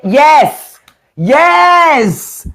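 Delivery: high, loud, eager yes; excited double yes to a friend's point. Real, not acted. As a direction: jump in with delight. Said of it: "excited agreement".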